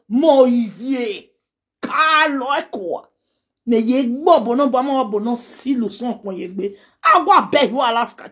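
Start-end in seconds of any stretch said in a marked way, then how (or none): none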